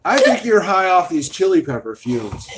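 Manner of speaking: loud, laughing tease; loud teasing. Real, not acted. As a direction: call it out teasingly across the table. Teasing daughter across the table.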